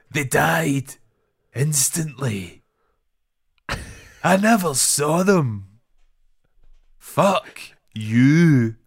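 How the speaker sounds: high, slow, deadpan mock line reading; mocking wooden actor. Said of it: "it felt like a table read".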